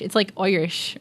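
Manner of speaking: a quick stab at an Irish accent; a brief Irish accent try; Irish accent attempt. Shown as a demonstration. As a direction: lightly put on Irish lilt for one word. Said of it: "Can you do an Irish accent?".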